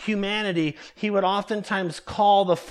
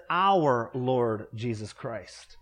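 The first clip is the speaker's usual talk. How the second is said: slow, low, lingering on OUR; savoring our; 7 semitones lower, 3 dB quieter, slower than usual. Shown as a demonstration. To the congregation, dwelling lovingly on "Our". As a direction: lean into "Our" with quiet reverence. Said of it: "I love where it says".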